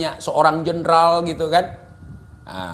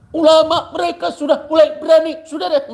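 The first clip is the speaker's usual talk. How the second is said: mock preacher; 9 semitones higher, 3 dB louder than usual. Mocking rival cleric's sermon.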